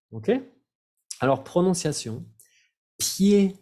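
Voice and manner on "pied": pied said as pje, silent D; French pronunciation. Shown as a demonstration. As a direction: say pied with no D sound at the end. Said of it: "No vayan a pronunciar ni la D ni la S".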